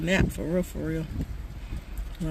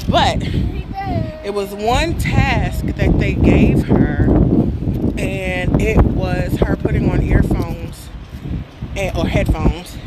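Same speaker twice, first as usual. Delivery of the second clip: excited; 9 dB louder, slower than usual. Real, not acted. Excited retelling of daughter.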